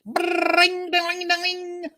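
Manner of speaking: high electronic beeping, softly; high sing-song robot beeps. Retold or imitated. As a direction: chirp like a little droid. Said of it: "beep like this".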